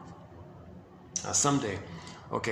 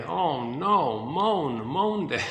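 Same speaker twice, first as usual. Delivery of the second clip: a mock wail on moan day, pitch rising at the end; hammed-up moan; 3 dB louder, much slower than usual. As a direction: groan it out like a lament, half in jest. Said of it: "Now we go into moan day".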